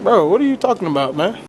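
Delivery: high, loud, outraged bro; loud protest. Real, not acted. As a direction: shout it in disbelief. Friend protests loudly.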